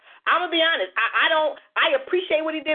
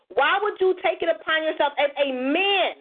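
ordinary delivery

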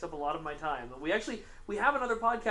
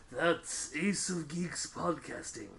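anime villain voice; 2 semitones lower, 5 dB quieter, much slower than usual. Shown as a demonstration. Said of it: "that's my anime villain voice".

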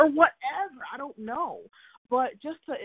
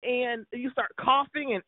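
normal talking voice

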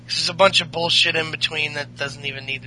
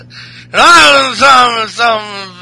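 put-on slow, drawn-out drunken bellow; loud, raised mock-drunk mumbling; drunk Sheamus; 5 semitones higher, 8 dB louder, much slower than usual. Shown as a demonstration. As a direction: slur it like a hammed-up drunk. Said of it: "just do a drunk one".

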